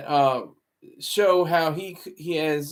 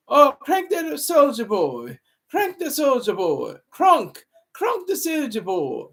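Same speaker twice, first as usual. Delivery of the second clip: hammed-up English accent, pitched much higher; terrible English accent; 8 semitones higher than usual. Host imitating London fans cranking [name], terrible English accent. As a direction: chant it high in a badly faked English accent. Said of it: "That's my terrible English accent".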